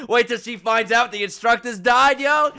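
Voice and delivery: loud, high-pitched incredulous outburst; hysterical disbelief. Real, not acted. Viewer reacting to absurd anime fight, hysterical disbelief. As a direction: yell it through rising laughter.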